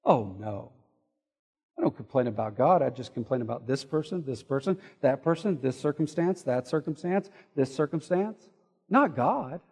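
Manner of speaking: low, quiet, far-off defensive voice; mock the excuse-maker, rolling each "this person"; self-justifying grumbler. Churchgoer excusing their complaints, mock-innocent and low. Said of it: "What would we say?".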